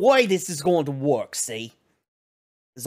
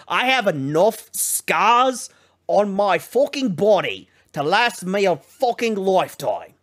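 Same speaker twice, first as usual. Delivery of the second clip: ordinary talk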